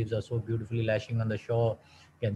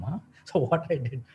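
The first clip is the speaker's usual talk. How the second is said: ordinary talk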